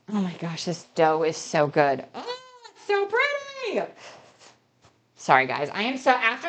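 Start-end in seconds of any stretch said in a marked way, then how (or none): 2.1-3.9 cooing high and soft. Real, not acted. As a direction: coo over it, high and soft. Cooing over dough.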